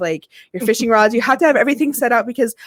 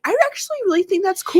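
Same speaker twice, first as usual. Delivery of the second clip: imitated earnest little boy; 9 semitones higher than usual. Parent quotes son accepting sibling's new name, high earnest child voice. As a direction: pitch it up like an approving child. Mimics small son's sweet reaction.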